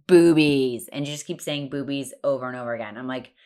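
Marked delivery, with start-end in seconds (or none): 0.1-0.9 much louder, blunt single word; loud toddler blurt. Retold or imitated. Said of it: "And she's like".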